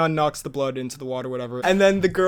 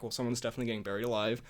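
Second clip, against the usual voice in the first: wry aside; 6 semitones lower, 12 dB quieter, much faster than usual. Retold or imitated. Retelling own wry thought at movie.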